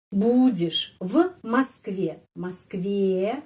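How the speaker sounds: Москве said slowly with stress on the last syllable ве; drawn-out Будешь в Москве, final syllable stressed; slow stress demo. Shown as a demonstration. Teacher showing learners where the stress falls, slowly. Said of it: "penekanannya di sini".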